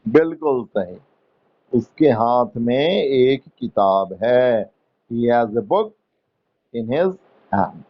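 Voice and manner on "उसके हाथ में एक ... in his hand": slow dictation. Shown as a demonstration. Teacher dictates answer slowly.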